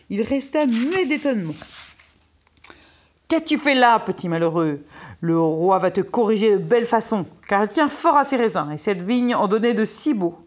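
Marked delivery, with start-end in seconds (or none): 3.3-10.4 loud, indignant telling-off; angry scolding. Acted. Jealous gardener finds his vine torn out, angry scolding. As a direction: scold the boy with force.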